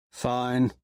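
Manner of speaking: flat, sullen monotone; a single flat note. Acted. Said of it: "Severo's voice is monotone".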